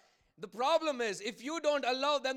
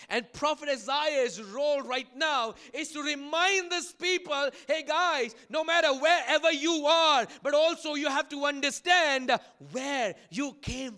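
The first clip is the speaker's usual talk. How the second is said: raised, pressing sermon voice; forceful preaching; 3 semitones higher, 7 dB louder than usual. Real, not acted. Preacher voicing Isaiah reminding his people, forceful preaching. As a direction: urge the congregation on with force.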